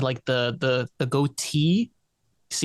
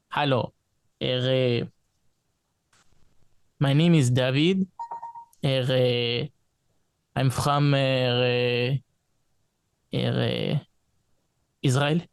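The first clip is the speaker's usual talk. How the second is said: put-on heavy Israeli English accent; broken English, thick Israeli accent. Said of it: "I could do Israeli accent".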